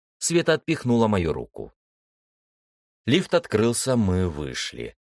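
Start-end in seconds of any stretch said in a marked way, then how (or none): none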